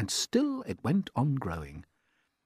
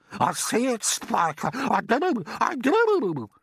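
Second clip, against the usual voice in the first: a much higher, louder put-on aunt's voice, excited; Aunt Sponge; 12 semitones higher, 6 dB louder, slower than usual. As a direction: play the greedy aunt gaping at the peach.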